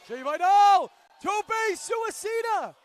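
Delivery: high, very loud announcer yell; excited full shout. Real, not acted. Commentator calling a dive through the ropes, full shout. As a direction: belt out the move's name.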